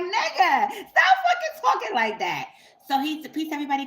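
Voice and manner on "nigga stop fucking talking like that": high, loud outburst through laughter; laughing protest. Real, not acted. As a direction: protest loudly while cracking up. Laughing protest at his way of talking.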